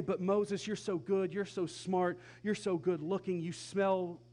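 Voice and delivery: gushing, loud and high compliments; butter him up, overdone; loud mock flattering; mock flattery. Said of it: "He doesn't say".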